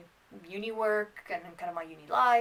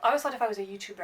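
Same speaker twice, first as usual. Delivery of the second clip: usual speaking voice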